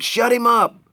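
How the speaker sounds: very high, loud panicked scream; frantic yell. Acted. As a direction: yell shut him up at the top of the voice. Panicked thug during a struggle.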